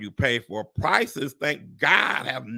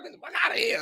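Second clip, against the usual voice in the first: high, scoffing disbelief; incredulous exclamation; 3 dB quieter, much faster than usual. Retold or imitated. Reacting to a car repair bill, high scoffing disbelief. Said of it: "you be like".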